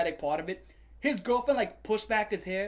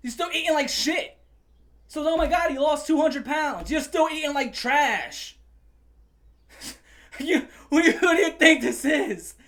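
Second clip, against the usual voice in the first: high, loud exasperation; exasperated rant; 8 semitones higher, 6 dB louder than usual. Real, not acted. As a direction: shout in disbelief at him. Escalating rant with laugh.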